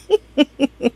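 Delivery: high, soft giggle; amused laugh. Real, not acted. Recapper imagining pouncing on him, high giggle. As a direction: crack up at your own joke.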